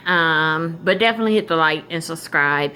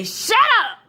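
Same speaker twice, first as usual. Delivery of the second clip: sharp shut up. Retold or imitated. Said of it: "I would say".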